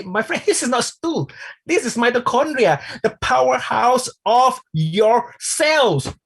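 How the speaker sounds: high-pitched, amused insistence; emphatic retold protest. Trainer correcting audience who mistook mitochondria for stool, emphatic protest. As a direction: replay your protest, high and drawn out at the end.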